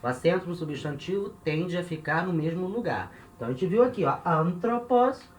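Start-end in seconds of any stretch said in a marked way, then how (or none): 4.2-5.3 anthropos slow and high, AN stressed; ANthropos, accent three syllables from the end; stress on first syllable. Shown as a demonstration. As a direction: stretch and lift AN in anthropos. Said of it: "o acento é na terceira sílaba".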